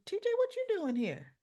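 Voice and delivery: high-pitched retold surprise; surprised greeting. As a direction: voice jumps up in acted astonishment. Stella startled to find T J.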